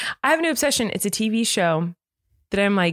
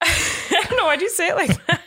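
laughing; 8 semitones higher than usual. Real, not acted. Laughing at odd moment.

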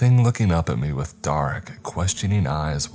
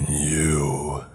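a rasping creature's whisper; hoarse whisper. Acted. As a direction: breathe it out hoarsely, like something ancient. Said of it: "a hoarse whisper escaped".

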